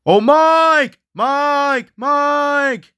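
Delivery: high, drawn-out panicked shout; panicked calling out. Acted. Lost friends screaming for Mike, panicked calling out. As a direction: holler the name in a panic. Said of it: "they start freaking out and panicking".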